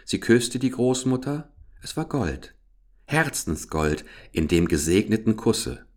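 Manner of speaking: ordinary delivery, nothing marked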